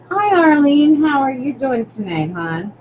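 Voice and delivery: high, loud hello; cheery greeting. Real, not acted. Host greets Arlene joining tonight, high loud greeting. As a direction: call it out high and loud to a newcomer. Greeting an arriving viewer by name.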